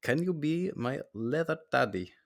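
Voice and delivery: hammed-up imitation of someone else's voice; very bad impression of a listener. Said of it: "you have to say it in his voice".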